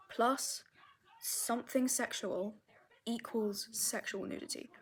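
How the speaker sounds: breathy whispered aside, unvoiced. Real, not acted. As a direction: whisper the punchline like a secret. Whispered punchline of her formula.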